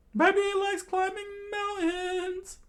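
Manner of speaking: very high, slow falsetto; drawn-out cartoon squeak; high-pitched onlooker voice. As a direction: squeak it out slowly in a put-on voice. Acted onlooker in the story.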